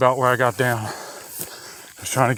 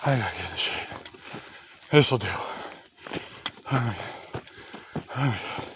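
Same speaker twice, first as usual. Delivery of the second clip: panting in the heat; winded, drained voice; out of breath; 8 dB quieter than usual. Real, not acted. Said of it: "watching me suck wind".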